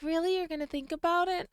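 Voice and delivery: high, soft questioning voice; softly voiced hopeful sweetheart; hopeful girlfriend. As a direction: ask it high and small, lightly acted. Acting hopeful Arabella questioning partner.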